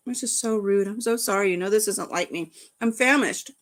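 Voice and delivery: usual speaking voice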